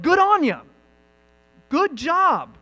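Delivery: high, loud cheer of approval; hearty praise. Acted. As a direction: call out congratulations with punch.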